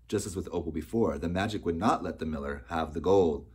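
ordinary delivery, nothing marked

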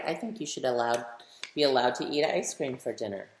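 plain reading voice

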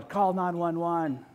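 drawn-out yell for help, slightly restrained. Retold or imitated. Father holding his injured daughter, yelling for help. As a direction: call out slowly to bystanders. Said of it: "I'm yelling".

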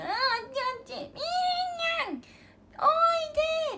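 put-on high sing-song child calling a name. Niece calling the cat-name in the store, high sing-song child call. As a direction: call out like a small child who wants to play.

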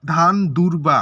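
ধান দুর্বা loud, each part set apart; demonstrated pronunciation. Shown as a demonstration. Teacher pronouncing a word to count syllables, loud and measured. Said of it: "এখানে যদি আমরা উচ্চারণ করি".

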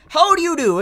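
mock Furuta greeting. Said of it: "and he's like".

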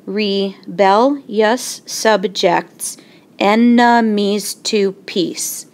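slow, syllable by syllable stress reading; scanned slowly. Shown as a demonstration. Teacher scanning a Shakespeare line for the class, slow and measured. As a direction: read it out marking each beat, slowly. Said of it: "This line has ten syllables".

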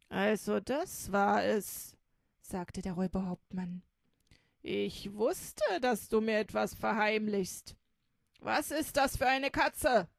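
loud, suspicious robber boss; gruff robber chief. Acted. Robber captain confronting Räubermitzi, loud suspicious voice. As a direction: accuse her, voice heavy with suspicion.

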